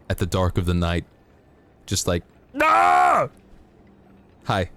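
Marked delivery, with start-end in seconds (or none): 2.6-3.3 a sudden very high, loud shriek; jump-scare yell. Shown as a demonstration. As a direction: burst out with a shrill scream to startle. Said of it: "Imagine that coming at you".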